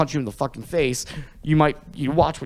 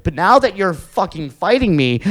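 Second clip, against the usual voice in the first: normal talking voice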